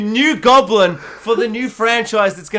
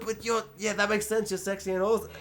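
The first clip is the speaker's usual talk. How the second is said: much softer, sheepish mumble; meek mock agreement; 2 semitones higher, 10 dB quieter, faster than usual. Fans giving in to Spider-Gwen, soft sheepish agreement. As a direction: concede it timidly.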